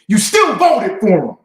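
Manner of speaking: loud, angry, the word still hammered hard; exasperated outburst. Real, not acted. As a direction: throw it at them like an accusation. Angry rant about voting.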